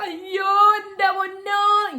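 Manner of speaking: high, loud, drawn-out wail of labour pain; slow, high, loud cry of agony; screaming in pain. Retold or imitated. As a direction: howl it out like the pain is unbearable. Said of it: "കിടന്ന് അലറുക".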